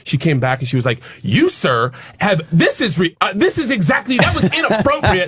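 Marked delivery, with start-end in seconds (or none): 1.2-5.3 high-pitched imitation of a scolding teacher; mock-scold, voice climbing high; oburzona nauczycielka. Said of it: "came back to yell at me".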